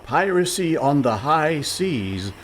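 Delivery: slow, drawn-out proclamation; dramatic slow reading. Acted. Reading the wanted poster to the pirates, slow dramatic proclamation. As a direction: read it out slowly, savoring each word.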